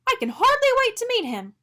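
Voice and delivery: can't contain the excitement; eager. A narrator's reading. Arnie eager to meet owner.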